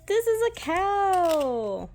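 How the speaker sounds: high, slow singsong naming the animal; teaching singsong. Real, not acted. Parent teaching toddler animal names.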